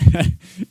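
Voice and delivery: short loud burst of laughter; real amused laugh. Real, not acted. Laughing at troll's accent claim.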